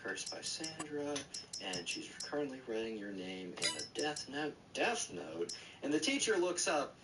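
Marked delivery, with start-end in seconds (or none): 0.0-5.5 soft, low mumbled reading; reading to oneself, barely voiced; mumbling. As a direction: mumble it under your breath as you read. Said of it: "like, mumbling".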